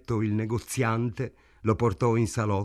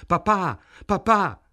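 frantic cry; 8 semitones higher, 6 dB louder than usual. A narrator's reading. Daughter finds father collapsed.

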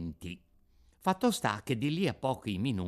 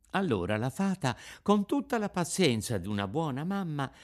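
ordinary delivery

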